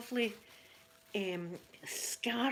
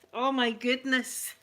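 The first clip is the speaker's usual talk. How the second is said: ordinary delivery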